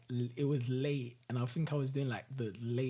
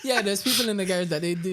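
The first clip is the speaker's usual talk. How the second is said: laughing; 5 semitones higher, 6 dB louder, faster than usual. Real, not acted. Telling a funny work story.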